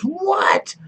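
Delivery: high startled yelp of disbelief; retelling the rider's jolt of shock; shocked what. Retold or imitated. Rider hearing the dog was eaten, shocked high what.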